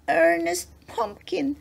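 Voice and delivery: high little bear voice; little teddy bear. A narrator's reading. Teddy finishing his introduction after a sneeze, little bear voice. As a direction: give the name as a small, high-voiced bear. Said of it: "said the little teddy bear".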